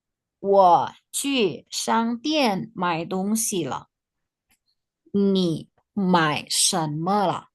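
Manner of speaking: slow, each word spaced out; slow model reading. Shown as a demonstration. Teacher reading the text for students to imitate, slow and measured. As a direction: read slowly for learners to copy. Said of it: "sambil dibaca teksnya nanti ditirukan".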